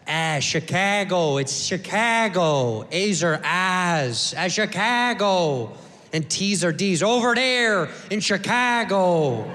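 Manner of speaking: slow, drawn-out mock Chicago accent. Comic recalling arguing men in thick Chicago accent. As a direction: imitate two Chicago guys yelling, slowly. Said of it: "with that thick Chicago accent".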